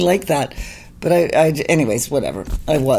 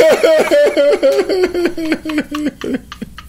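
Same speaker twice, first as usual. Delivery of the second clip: long high helpless laughter; real laughing fit; 9 semitones higher than usual. Real, not acted. Cracking up at her own punchline, real laughter. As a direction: crack up completely, out of words.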